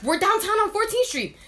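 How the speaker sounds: high, loud burst of realization; worked-up, rising to near shouting; retold excited idea. Retold sudden bright idea.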